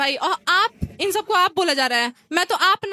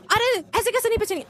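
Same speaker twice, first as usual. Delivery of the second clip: retold yell; 6 semitones higher than usual. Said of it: "इतना चिल्ला के बोले".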